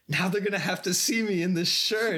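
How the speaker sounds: high-pitched imitation British accent; mock-indignant British accent; Russell Brand. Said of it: "just the accent makes everything funny".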